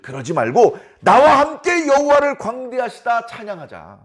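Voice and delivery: high, emphatic call to praise; rally friends to join in; acted earnest appeal; forceful urging. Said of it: "더 강하게 얘기를 합니다".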